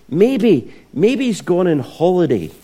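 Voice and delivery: high-pitched mock suggestion; hammed-up teasing, pitched well up; having some fun. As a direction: taunt them with playful false helpfulness. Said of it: "[name]'s having some fun".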